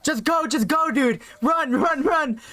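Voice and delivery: mock yell to hurry someone along, pitched high; retold yell. Yelling at friend to run from oncoming car, retold high yell. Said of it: "I yell out to him".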